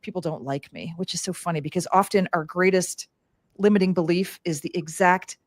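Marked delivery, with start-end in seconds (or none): 0.0-0.9 soft, hushed self-doubt; quiet and small. Retold or imitated. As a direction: say it small, like a secret fear. Quoting her hardest belief.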